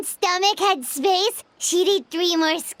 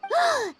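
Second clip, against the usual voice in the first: startled gasp; 10 semitones higher than usual. Acted. Spots an intruder at night.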